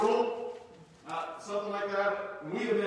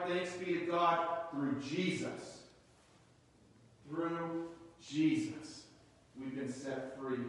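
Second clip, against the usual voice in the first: slow, soft, lower thanksgiving; quiet thanks; 7 semitones lower, 6 dB quieter, slower than usual. Real, not acted. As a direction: let it settle in gratitude.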